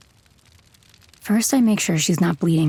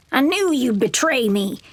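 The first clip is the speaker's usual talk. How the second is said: higher, bitter snarl; 6 semitones higher than usual. Acted. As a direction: spit it like a cornered animal. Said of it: "she snarls".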